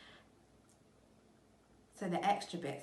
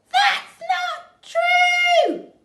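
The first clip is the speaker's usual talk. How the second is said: very high, very loud, slow shriek; shrieking cry; 20 dB louder, much slower than usual. Acted. Missus Twit screaming at her husband in disbelief. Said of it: "cried Missus Twit".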